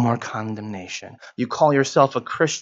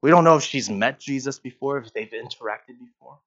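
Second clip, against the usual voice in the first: ordinary delivery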